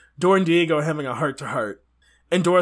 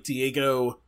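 ordinary talk, nothing marked

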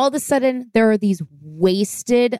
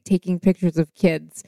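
ordinary talk, nothing marked

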